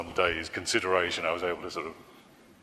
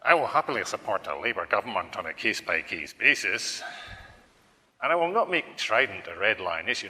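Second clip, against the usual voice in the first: put-on Scottish accent; measured politician in a Scottish accent; Nicola Sturgeon. SNP leader stating terms to Labour, Scottish accent. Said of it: "Nicola Sturgeon".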